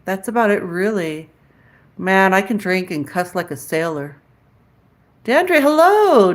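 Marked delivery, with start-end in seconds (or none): 5.2-6.3 drawn-out greeting. Real, not acted. Greeting a viewer by name.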